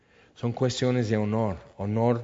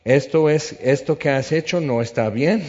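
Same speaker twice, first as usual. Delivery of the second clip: loud scolding; 4 semitones higher, 6 dB louder than usual. Acted. David scolding Abner.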